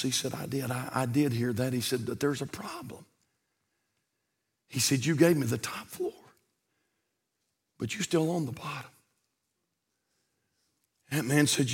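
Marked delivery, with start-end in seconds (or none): 0.3-1.7 hushed, low-key answer; quiet Lord voice. Acted. The Lord gently telling the homeowner, hushed and quiet. 2.0-3.1 hushed, low-key answer; quiet Lord voice. Acted. The Lord gently telling the homeowner, hushed and quiet. 5.1-8.9 hushed, low-key answer; quiet Lord voice. Acted. The Lord gently telling the homeowner, hushed and quiet.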